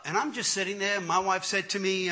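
ordinary talk

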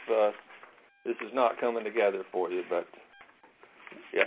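normal talking voice

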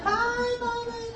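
put-on high slow sing-song childish wail; kids wailing. Mother mimicking kids wailing over five whippings. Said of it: "They'd be like".

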